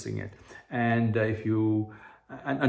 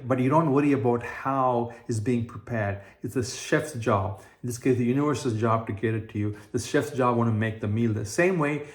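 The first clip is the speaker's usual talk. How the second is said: ordinary delivery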